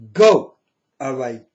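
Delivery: loud furious Go; angry shout. Real, not acted. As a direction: bark the word at them. Angrily telling followers leave.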